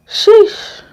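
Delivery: high, loud shocked sheesh; a sharp squeaky sheesh of disbelief. Real, not acted. Reacting to the killer caller story, a shocked sheesh.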